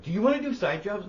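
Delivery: a high, loud mock of pushy teachers; mock eager teachers. Teachers asking the custodian for side jobs, high and loud mock.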